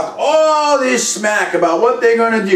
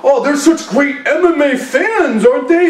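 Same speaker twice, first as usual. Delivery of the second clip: sarcastic mock quote; 4 semitones higher than usual. Mocking the Montreal fans.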